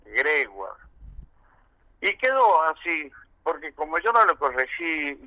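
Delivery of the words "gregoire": Gregoire as grewa, soft and stressed on the last syllable; accent on the A. Shown as a demonstration. Showing how the announcer said Gregoire, grewa. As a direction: Gregoire said grewa, a bit quieter. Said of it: "lo acentuó así".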